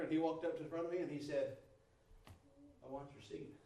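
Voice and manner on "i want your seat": softly, no raised voice; a quiet claim on the seat; quiet calm demand. Retold or imitated. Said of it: "didn't have to say it with a loud voice".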